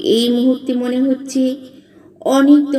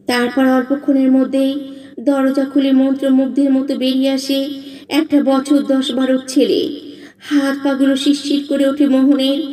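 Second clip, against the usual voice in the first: plain reading voice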